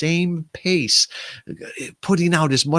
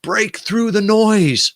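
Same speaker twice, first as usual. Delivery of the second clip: raised, slow mock shout of the usual advice; exaggerated loud, higher, drawn-out call; mock yell; 9 semitones higher, 4 dB louder, slower than usual. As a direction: belt it out like a pushy marketer. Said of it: "So many times people are telling us".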